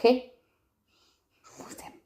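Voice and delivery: slow soft whisper. Real, not acted. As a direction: breathe it out slowly. Soft whispered sign-off to viewers. (je vous aime, chuchoté lentement)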